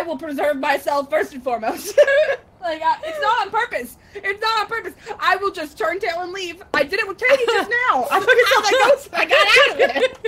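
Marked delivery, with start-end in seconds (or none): 2.6-10.2 high, rising protest breaking into laughter; laughing self-defence. Real, not acted. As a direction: plead your case higher and louder, laughing. Defending herself for abandoning teammates.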